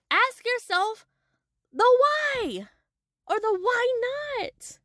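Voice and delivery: high, fervent urging to listen to yourself; passionate high exhortation. Real, not acted. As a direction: preach it, voice climbing high.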